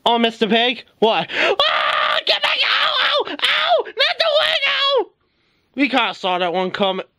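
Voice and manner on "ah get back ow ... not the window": very high-pitched shrieks of pain; panicked squealing. Acted. Character under attack near the window, panicked squealing. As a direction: squeal each cry at the very top of the voice.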